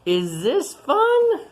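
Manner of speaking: high, loud, slow drawn-out question; hammed-up question. Asking the children if Bigfoot has fun, hammed-up. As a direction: stretch it out like a big silly puzzle.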